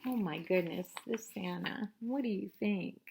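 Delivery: usual speaking voice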